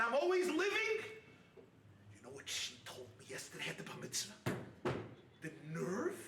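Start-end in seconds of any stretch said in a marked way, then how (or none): 2.1-6.2 muttered grievance. Retold or imitated. Mimics a bitter grumbler.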